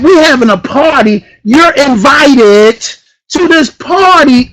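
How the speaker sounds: high, loud party hype; hyped up. Real, not acted. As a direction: whip the crowd up. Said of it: "Am I a little bit too hyped".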